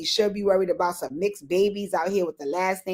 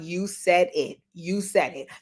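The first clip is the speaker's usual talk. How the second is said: ordinary talk, nothing marked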